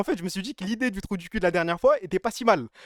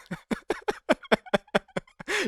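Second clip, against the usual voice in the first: high embarrassed laugh; 10 semitones higher than usual. Real, not acted. Streamer cracking up at his own shameful slides, high laughter. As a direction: laugh helplessly at yourself.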